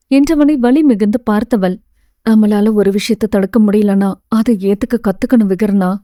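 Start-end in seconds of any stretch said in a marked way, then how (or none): none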